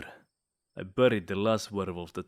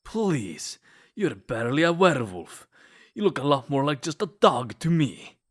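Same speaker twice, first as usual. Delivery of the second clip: louder, higher, scornful jab at a rival; mocking taunt; 4 semitones higher, 5 dB louder than usual. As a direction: sneer it out to belittle him.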